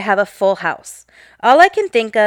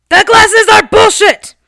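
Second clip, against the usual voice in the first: put-on much higher and louder, a cranky old man's courtroom outburst; angry old man outburst; 11 semitones higher, 9 dB louder than usual. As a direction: explode at the judge like a furious old man.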